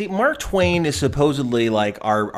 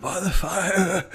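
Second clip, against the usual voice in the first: slow, higher-pitched silly voice; mock character impression; 5 semitones higher, slower than usual. Echoing the co-host's impression, slow and high. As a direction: stretch it out in a put-on voice. Echoes the mimicked line from Masks.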